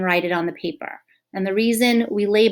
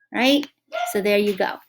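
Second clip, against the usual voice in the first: ordinary delivery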